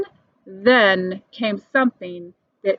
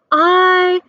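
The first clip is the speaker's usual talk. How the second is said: a higher called-out vote; shout; 7 semitones higher, 4 dB louder than usual. A narrator's reading. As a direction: call aye out, higher. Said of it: "shouted Thidwick".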